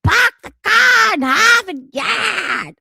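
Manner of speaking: loud, high, slow Boston shout of "Park the car in Harvard Yard"; Boston accent. Retold or imitated. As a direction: belt it out in an exaggerated Boston accent.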